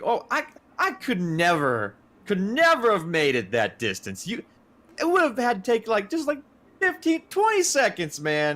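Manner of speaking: high-pitched imitation of pleading innocence; hammed-up high-voiced alibi; mock protest. Listener acts out the feigned innocence.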